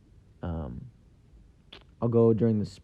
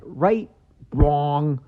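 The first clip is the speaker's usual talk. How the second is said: imitated wiseguy, high rising question, then louder answer; Joe Pesci; 5 semitones higher, 6 dB louder than usual. Comedian quoting Pesci's question and answer, punchy impersonation. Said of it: "Just the way Joe Pesci is".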